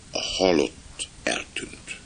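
slow, suspenseful whisper; hushed shock. A narrator's reading. Narrator revealing the dead man has vanished, hushed. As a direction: breathe it out slowly, stunned.

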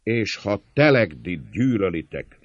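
forceful, only moderately loud cry; straining shout. A narrator's reading. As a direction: call out over a clamouring crowd. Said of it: "kiáltta Száleresi minden erejét megfeszít".

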